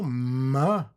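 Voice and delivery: "ma" with a long hummed m through the nose; nasal consonant. Shown as a demonstration. Teacher demonstrating a nasal sound, drawn-out "ma". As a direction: hold the nasal m, then open into "ma". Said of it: "we have a nasal".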